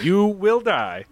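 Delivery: slow, hammed-up doom warning; mock ominous warning. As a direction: drag it out like a spooky threat. Mock warning about drinking game.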